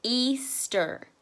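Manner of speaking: Easter drawn out as Ea, ster; syllable by syllable. Shown as a demonstration. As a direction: break Easter into two clear beats for the class. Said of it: "we'll say Easter together".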